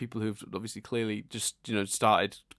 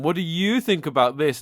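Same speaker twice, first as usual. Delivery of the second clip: lightly mocked YouTuber outro question, raised and louder; YouTuber imitation; 6 semitones higher, 7 dB louder than usual. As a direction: imitate a YouTuber asking viewers for comments. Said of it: "I hate when YouTube channels do that".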